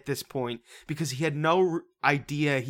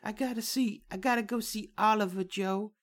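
higher, softer fragile voice; mocking Emily; 8 semitones higher, 3 dB quieter than usual. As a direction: plead it high and soft. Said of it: "and she's like".

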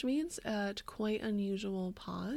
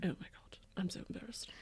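whispered embarrassment; 3 semitones lower, 13 dB quieter, faster than usual. Pretend. Mock embarrassment.

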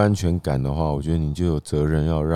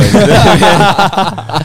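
laughing; 12 semitones higher, 8 dB louder, much slower than usual. Real, not acted. Teasing a married cohost.

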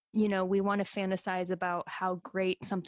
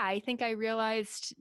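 ordinary talk, nothing marked

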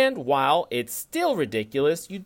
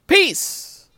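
loud, high shout of peace; excited sign-off; 13 semitones higher, 8 dB louder than usual. Acted. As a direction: belt out a farewell.

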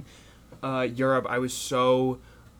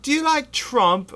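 much higher, louder, slow mock cockney kid; cockney teen; 9 dB louder than usual. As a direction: needle the Americans like a cheeky teen. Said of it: "cockney little British kids".

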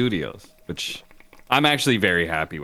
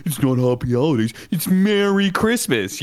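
mock Christmas zealot; 6 semitones higher than usual. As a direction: ham up the Merry Christmas crusader. Mocking right-wing Christmas people.

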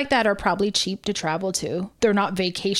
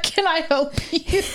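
higher-pitched, laughing imitation of a neighbor; imagined puzzled neighbor; 7 semitones higher than usual. Listener voicing neighbor who catches her bent down, mock puzzlement. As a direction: play the baffled neighbor through a laugh. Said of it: "He's like".

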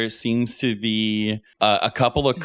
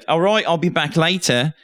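put-on higher British-accented student's goodbye; 5 semitones higher than usual. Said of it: "where's my British accent at".

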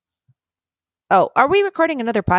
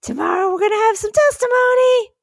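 excited; 11 semitones higher than usual. Acted. Excited announcement.

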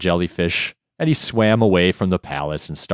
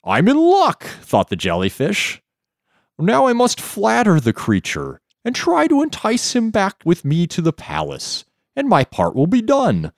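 high, wavering jellyfish voice; wobbly floating jellyfish; 13 semitones higher than usual. Acted. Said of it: "I love the jellyfish voice".

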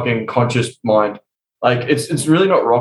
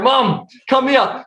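higher, drawn-out, slow call; calling mum slyly; 10 semitones higher, much slower than usual. Retold or imitated. Son calling mum over to show a trick, slow and high. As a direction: lure her over in a slow sing-song.

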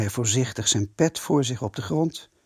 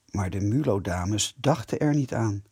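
plain reading voice

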